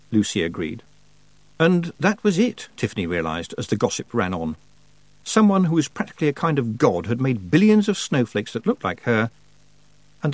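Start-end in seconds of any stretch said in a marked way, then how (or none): none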